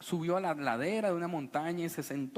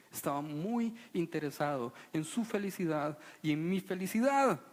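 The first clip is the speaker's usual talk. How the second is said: ordinary talk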